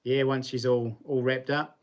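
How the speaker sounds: normal talking voice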